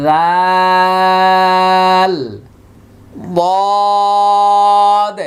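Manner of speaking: জাল and বদ held long and loud; letter contrast demo. Shown as a demonstration. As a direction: draw out জাল then punch বদ heavy. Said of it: "এটা মোটা, এটা পাতলা".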